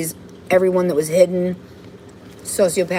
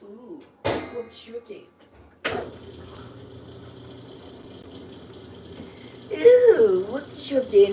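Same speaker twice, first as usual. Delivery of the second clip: sarcastic sing-song; 4 semitones higher, 6 dB quieter, slower than usual. Real, not acted. Gloating alone in the house.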